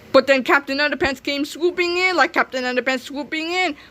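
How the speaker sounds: plain reading voice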